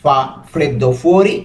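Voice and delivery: "Fa freddo fuori?" said very slowly, rising at the end; slow teacher demo of "Fa freddo fuori?"; slow model question. Shown as a demonstration. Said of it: "একসাথে কি হবে?".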